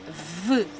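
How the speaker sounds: "v" as a low, soft sound, not the letter name; isolated speech sound. Shown as a demonstration. Teacher isolating the first sound of viola, low soft "v". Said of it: "Começa pelo mesmo som".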